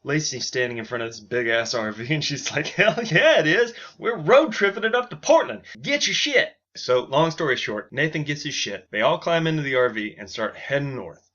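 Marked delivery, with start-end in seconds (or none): 2.7-6.5 high, loud whooping brag; hyped shout. Acted. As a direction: pump everyone up, much higher and louder. Lacey hyped beside the RV.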